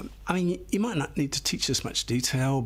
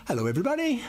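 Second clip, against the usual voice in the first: cheery teacher greeting; 8 semitones higher, much faster than usual. Shown as a demonstration.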